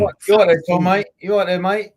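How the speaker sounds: loud, high hail across the room; calling out. Real, not acted. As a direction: shout over to a mate. Calling out to Tom.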